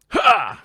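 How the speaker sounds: sharp karate kiai; karate yell. Acted. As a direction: bark a short kiai. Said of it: "Karate kick sound, not laugh".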